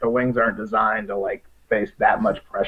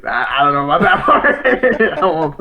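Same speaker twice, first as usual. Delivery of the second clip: laughing through the words; chuckling while saying it; 3 semitones higher, 5 dB louder than usual. Real, not acted. Said twice, first through laughter.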